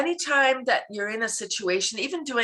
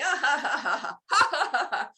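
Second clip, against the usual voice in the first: high, forced rolling "ah-ha-ha"; laughter-yoga belly laugh on purpose; demonstrated laughter; 8 semitones higher than usual. Shown as a demonstration. Coach showing her laugh at being cut off, hearty fake laugh. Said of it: "I'm laughing".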